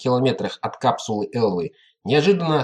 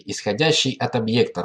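plain reading voice

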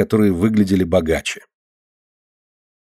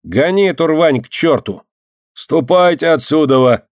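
higher-pitched angry order; yelling; 6 semitones higher than usual. A narrator's reading. Boy and yardkeeper driving beggars out, yelling. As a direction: raise the voice to drive them off. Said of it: "закричал дворник".

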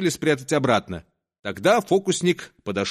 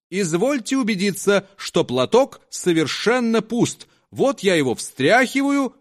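lightly raised showman voice; a hint of a nervous fairground conjuror; conjuror's patter; 7 semitones higher than usual. Conjuror to the audience, showing the handkerchief, showman patter.

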